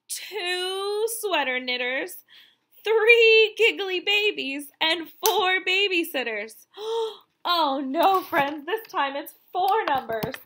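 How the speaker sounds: ordinary delivery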